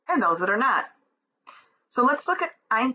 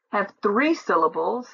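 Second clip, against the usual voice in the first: usual speaking voice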